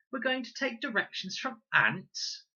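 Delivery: high, loud mocking question; scornful disbelief. A narrator's reading. Con mocking Fred's ant idea, high and scornful. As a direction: sneer at the idea.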